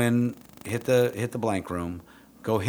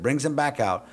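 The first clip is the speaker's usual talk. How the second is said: ordinary talk, nothing marked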